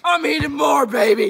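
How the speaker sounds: loud, proud shout; triumphant shout. Real, not acted. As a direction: yell it out like a victory. Triumphant while eating chicken foot.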